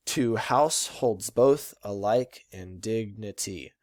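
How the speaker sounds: slow "Two house holds both alike in dignity", every second syllable stressed; emphasized iambic meter. Shown as a demonstration. Teacher reads Shakespeare to show meter, stressed iambs. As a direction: beat out "house", "both" and the other even syllables. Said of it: "I did a little something with my voice".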